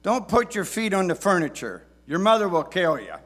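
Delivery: loud, high scolding father; stern dad voice. Retold or imitated. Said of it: "words that many dads have said".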